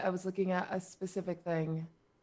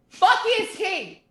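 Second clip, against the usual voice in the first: acted exasperated shout; 12 semitones higher, 5 dB louder than usual. Bard looking around in the dark, acted shout. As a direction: voice jumping high and louder. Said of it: "I look around and go".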